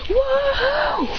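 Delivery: high, long whoop at normal volume; gleeful whoop. Acted. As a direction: pretend whoop of soaring joy. Character cheers while flying.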